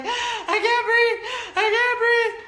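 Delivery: high, loud, slow, gasping with laughter; crying from laughing too hard. Real, not acted. Mother in tears laughing at the ball's answer. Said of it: "I was doing that cry".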